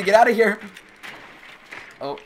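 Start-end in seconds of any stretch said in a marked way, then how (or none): 0.0-0.6 loud high yell; shout. Real, not acted. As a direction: yell it at the enemy. Yelling at enemy player.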